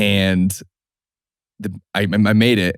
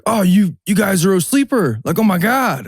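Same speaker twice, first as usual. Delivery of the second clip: much higher, gushing mock kid voice; excited fan kid; 11 semitones higher than usual. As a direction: squeal it like an awestruck teen. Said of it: "this kid's like".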